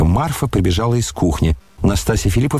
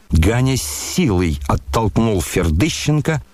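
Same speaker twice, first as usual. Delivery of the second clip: ordinary delivery, nothing marked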